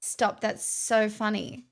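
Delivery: ordinary talk, nothing marked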